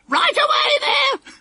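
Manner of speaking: very high, slow squawked call; parrot mimicking guard. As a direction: parrot imitating a railway guard. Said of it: "just as she'd heard a guard say".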